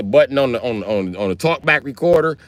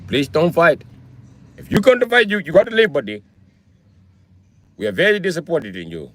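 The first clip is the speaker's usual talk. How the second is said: imitated Indian accent, pitched up at the start; 5 semitones higher than usual. Gas station clerk to banned customer, mock Indian accent. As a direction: put on a polite, pleading Indian accent. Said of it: "if the gas station dudes is Indian".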